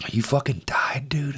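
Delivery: hushed, breathless disbelief; awed whisper. Real, not acted. Friend whispering in shock at the story. Friend hears of near death.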